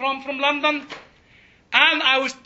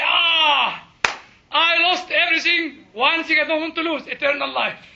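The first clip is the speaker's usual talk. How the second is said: loud, raised cry of anguish, easing off; agonised cry; 4 semitones higher, 5 dB louder than usual. Acted. Believer crying to God over losing everything, agonised cry. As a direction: cry out to God as if all is lost. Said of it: "in my agony, I'll say".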